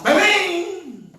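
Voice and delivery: loud, high burst; sudden exclaim. Real, not acted. Recalling the moment he met Todd, shouted bang. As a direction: explode the word out.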